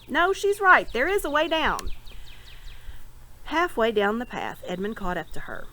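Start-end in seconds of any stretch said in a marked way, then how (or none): 0.1-1.9 excited call. A narrator's reading. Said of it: "Edmund's voice sang".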